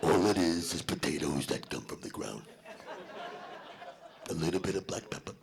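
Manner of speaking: low, hushed, sweet-talking whisper; coaxing whisper. Retold or imitated. Comic coaxing Ray to try potato salad, low hushed whisper. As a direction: lure him in softly like a child.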